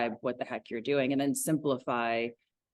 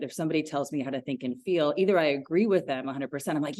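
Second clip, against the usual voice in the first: normal talking voice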